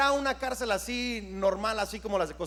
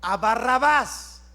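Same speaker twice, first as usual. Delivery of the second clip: slow crowd demand; 2 semitones higher, 3 dB louder, much slower than usual. Retold or imitated. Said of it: "Y la gente gritó".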